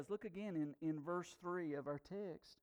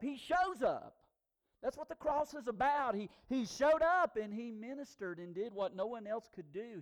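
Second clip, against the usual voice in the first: ordinary talk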